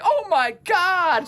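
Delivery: high, slow gasp of shock; shocked sister. Retold or imitated. Sister Kelly hearing he fell in, slow high shock. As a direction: drag out the shock.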